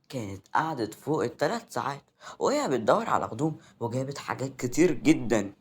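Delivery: usual speaking voice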